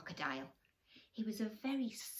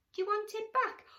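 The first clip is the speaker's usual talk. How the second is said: much higher, squeaky mouse; little mouse voice; 8 dB louder than usual. Acted. The mouse offers the tooth to the crocodile, squeaky. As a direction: ask it brightly and small. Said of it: "answered the mouse".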